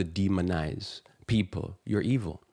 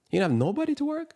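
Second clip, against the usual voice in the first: incredulous mock question; faster than usual. Preacher scoffing at the bosses, high incredulous squeak. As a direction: voice shoots way up in disbelief. Mocking the managers' hypocrisy.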